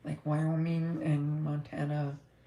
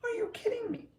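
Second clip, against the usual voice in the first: incredulous; faster than usual. Retold or imitated. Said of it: "I'm thinking".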